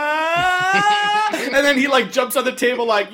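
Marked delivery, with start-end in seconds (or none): 0.1-1.3 short high roar; a high, silly attack roar; mock roar. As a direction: let out a cartoonish battle roar.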